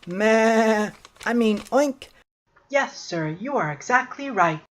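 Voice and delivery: high piglet voice, mildly cowed; a little scared. Acted. Pig answers old Duck, a little scared. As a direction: correct yourself meekly as a little pig. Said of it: "little pig was a little scared of old".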